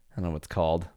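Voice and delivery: usual speaking voice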